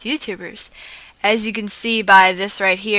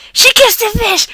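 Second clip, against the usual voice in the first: yell; 14 semitones higher, 10 dB louder than usual. Acted. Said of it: "we heard someone yell".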